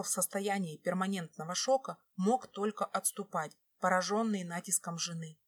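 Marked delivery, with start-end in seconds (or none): none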